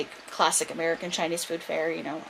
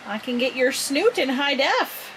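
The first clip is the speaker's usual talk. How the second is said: high, slow, playful lilt; drawn-out sing-song pet voice; cooing pet talk; 8 semitones higher, slower than usual. Real, not acted. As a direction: show off a new toy to a pet. Cooing to pet about camera.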